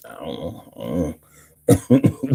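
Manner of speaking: a low put-on mumble; slow, deep put-on voice; lightly voiced low character; mock low voice. Put-on voice, reading names.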